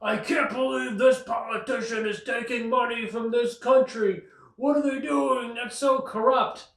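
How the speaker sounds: mock outraged citizen. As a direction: squeal the outrage like a scandalised voter. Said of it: "whenever people get upset about".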